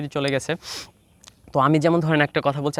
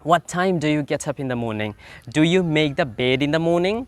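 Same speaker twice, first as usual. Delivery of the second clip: slow drill questions; much slower than usual. Shown as a demonstration. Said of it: "আপনি একা একা প্রশ্ন করতে হবে".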